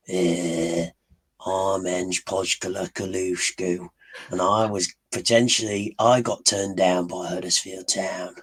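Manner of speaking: Australian football manager, slow drawn-out introduction; Ange Postecoglou impression. Shown as a demonstration. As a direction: imitate an Aussie manager, dragging out the name. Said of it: "you'd sound like Ange Postecoglou".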